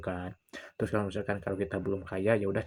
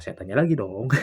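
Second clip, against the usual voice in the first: ordinary delivery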